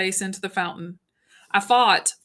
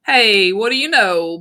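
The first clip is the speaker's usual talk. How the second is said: put-on loud, slow drunken jeer; drunk Soc taunt; 7 dB louder than usual. Drunk Soc jeers at the greasers, loud and slow. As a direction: sneer it out, a little unsteady. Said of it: "a little unsteadily".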